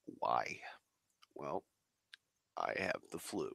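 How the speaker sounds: usual speaking voice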